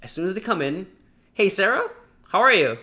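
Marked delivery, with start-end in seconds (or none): 1.3-2.8 loud, high, cheerful hello; upbeat greeting. Shown as a demonstration. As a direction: open the call with a big smile in the voice. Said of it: "You're gonna look at the camera".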